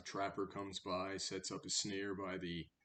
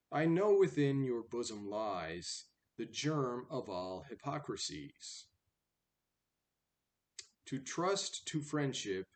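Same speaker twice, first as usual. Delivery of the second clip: put-on louder, measured recital of old verse; poem reading; 5 semitones higher, 7 dB louder than usual. Reader reciting the fable poem to the class, in full recital voice.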